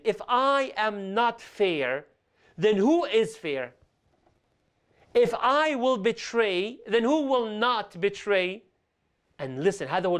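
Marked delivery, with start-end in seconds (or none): 0.0-8.6 slow, weighty rhetorical questions, each phrase given room; slow and solemn. Retold or imitated. Preacher voicing the Prophet's reply to the accuser, slow and grave. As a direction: let every word land as a reproach.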